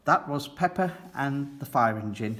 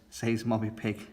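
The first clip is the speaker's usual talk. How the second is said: ordinary delivery, nothing marked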